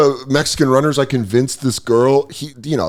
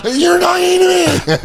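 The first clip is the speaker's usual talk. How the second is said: panicked shriek. Retold or imitated. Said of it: "I just melt down".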